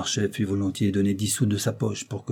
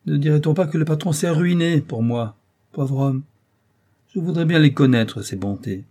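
higher-pitched, sarcastic rascal; sly mockery; 6 semitones higher, 5 dB louder than usual. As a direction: scoff with crafty insolence. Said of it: "de sa voix la plus odieusement rouée".

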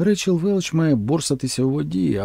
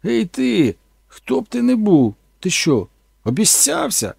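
higher, louder, slow accusation; accusing actress; 8 semitones higher, 4 dB louder, slower than usual. Retold or imitated. Actress turning on the creature, slow accusing. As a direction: confront him suspiciously. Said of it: "подивилась на мене і сказала".

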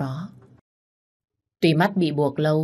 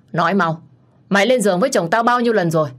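louder and fast, hammering the question; furious demand; 4 semitones higher, 5 dB louder, faster than usual. Acted. Wife interrogates the mistress.

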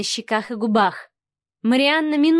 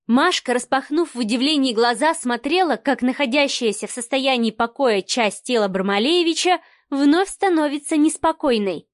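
ordinary delivery

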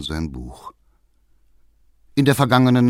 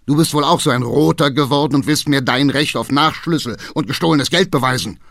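loud, raised, hammering reproach; angry accusation; 5 semitones higher, 5 dB louder, faster than usual. A narrator's reading. Father accusing his son of being a Red, angry. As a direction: pound out the accusation at him.

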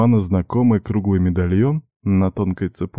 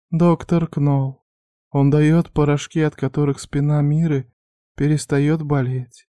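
put-on higher, timid young woman; young girl voice; 8 semitones higher than usual. A narrator's reading. Girl's voice again.

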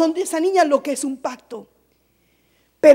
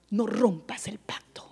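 whisper; 8 semitones lower, 13 dB quieter than usual. Real, not acted. Whispered repeat of warning.

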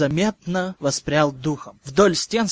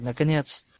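ordinary delivery

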